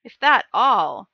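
a loud, slow roar of contempt; roaring warrior. A narrator's reading. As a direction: roar it at the young ruler. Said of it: "roared Doc Kova".

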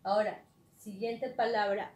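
normal talking voice

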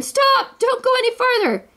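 very high, frantic storybook yell. A narrator's reading. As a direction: cry out in alarm without full volume. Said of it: "then yelled again".

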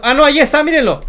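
high, loud, excited outburst; surprised discovery. Real, not acted. Gamer suddenly finds missing pig, surprised. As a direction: burst out as you spot it.

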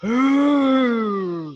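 long, low imitation roar; low pretend lion growl. Shown as a demonstration. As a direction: roar like a lion on a falling note. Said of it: "roar like a lion".

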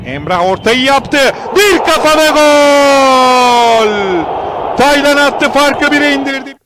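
screaming football commentator. Real, not acted. Goal call, very loud.

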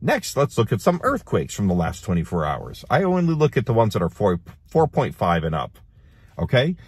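ordinary delivery

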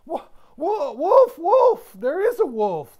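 high, loud terrified stammer; panicked cry. A narrator's reading. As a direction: scream for help in panic. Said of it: "the boy cried as he scrambled".

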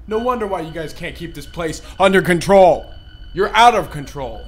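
loud scolding rant. Real, not acted. Player scolds the ghosts for the chaos, loud rant. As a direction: tell them off at full volume.

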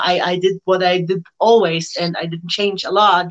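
ordinary delivery

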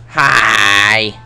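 loud cheery hi. Real, not acted. Running into enemies.

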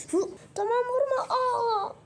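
high crying plea not to be hit; whimpering kid. Acted. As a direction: beg in a high tearful squeak. (dövülmekten korkan çocuğun tiz ağlamaklı sesi)

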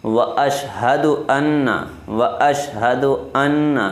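slow "واشہد ان" with a held doubled n; careful recitation. Shown as a demonstration. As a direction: stretch out "ان". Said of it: "teacher models recitation".

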